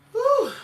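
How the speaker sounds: high, loud relieved exhale; high whew. Real, not acted. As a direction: blow it out after the rant. After the rant.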